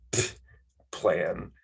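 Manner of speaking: soft, dismissive scoff. Acted. Brushing off a friend's question, scoffing. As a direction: puff out air, then toss the word away quietly. Scoffs at being asked for plan.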